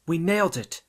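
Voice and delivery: high, loud victory cheer; triumphant. A narrator's reading. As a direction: celebrate the win out loud. Triumphant cheer after finding proof.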